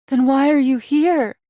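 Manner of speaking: high, loud despairing question; loud, pleading outburst at her lover; anguished cry. A narrator's reading. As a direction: cry out in fear for him. Frightened lover cries out.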